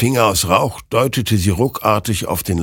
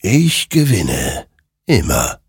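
slow, separated words, dropping after a raised start; triumphant apparition; slower than usual. Acted. Creature claiming every war, slow and ominous. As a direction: pause between the words, sinking eerily.

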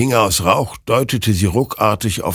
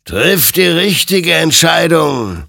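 loud, high, drawn-out warning; urgent admonishing; 11 semitones higher, 7 dB louder than usual. A narrator's reading. Creature warns Hector before he shoots, admonishing loudly. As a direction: admonish with a slow, swelling call. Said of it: "ermahnte ihn sein Gegenüber".

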